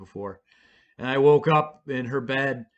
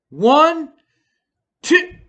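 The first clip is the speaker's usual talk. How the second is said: counting much higher and louder; 13 semitones higher, 9 dB louder than usual. Retold or imitated. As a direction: call the count out like a nurse. Nurse counting before yank.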